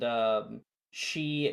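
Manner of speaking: ordinary talk, nothing marked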